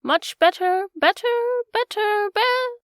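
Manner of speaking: loud, climbing into a high sheep-like bleat; rising to squeak. A narrator's reading. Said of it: "rising to a squeak as she went on".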